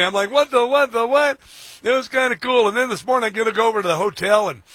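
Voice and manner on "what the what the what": high, loud bewildered repeats; mock astonishment. Host retells seeing the IRS head, mock astonished. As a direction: act out being floored, pitched up. Acts out own startled reaction.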